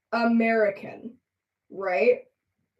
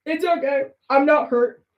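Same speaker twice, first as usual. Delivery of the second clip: put-on a higher, petulant little-kid pout; four-year-old coded; 6 semitones higher, 5 dB louder than usual. Quoting the pouting villain like a sulky four-year-old. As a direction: sulk like a toddler who was told no. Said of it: "he's so four-year-old coded".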